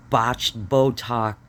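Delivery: slow, each word spat out; slow scornful emphasis on botched Botox; drawn-out insult. Real, not acted. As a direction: savour the words botched Botox slowly. Insulting a politician slowly.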